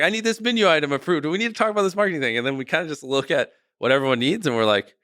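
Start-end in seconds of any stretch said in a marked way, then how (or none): 0.0-2.4 high, fast mock demand; Beast Burger team voice. Restaurant team pressing the boss for approvals, high and fast. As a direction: rattle off the requests like a frantic manager. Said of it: "Beast Burger's like".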